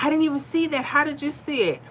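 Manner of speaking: high-pitched amazed question; amazed viewers. Retold or imitated. Viewers reacting to her video, asking in high amazement. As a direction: sound amazed at the catch.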